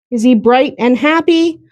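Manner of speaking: ordinary talk, nothing marked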